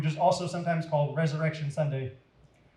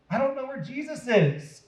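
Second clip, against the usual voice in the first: higher, urgent plea; 9 semitones higher than usual. Acted. Mary pleading urgently with the gardener. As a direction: press it out, desperate to find him. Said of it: "Mary said urgently".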